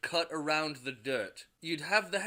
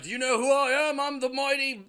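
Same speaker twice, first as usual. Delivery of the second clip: much higher, louder grand boast; pompous shout; 10 semitones higher, 6 dB louder than usual. Acted. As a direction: bellow your own greatness. Thor boasts, fighting orderlies.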